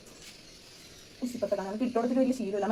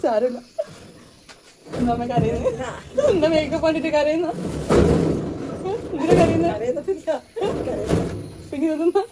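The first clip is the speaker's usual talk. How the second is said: higher, louder playful teasing of a teary mother; teasing sing-song; 9 semitones higher, 5 dB louder than usual. Real, not acted. Speaking to her tearful mother, teasing and loud. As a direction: coax her out of tears with bright mock surprise. Daughter teases crying grandmother.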